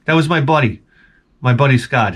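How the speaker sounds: ordinary talk, nothing marked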